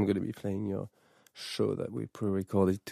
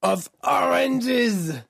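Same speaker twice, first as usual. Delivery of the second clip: very high, slow, hammed-up French knight; Monty Python French knight; 13 semitones higher, much slower than usual. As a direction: belt it out like a mocking French knight. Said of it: "'Cause that's how he said it".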